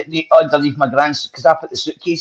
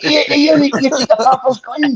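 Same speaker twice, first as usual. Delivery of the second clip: accusing grandpa; 13 semitones higher than usual. Retold or imitated. Grandpa catching him with gun.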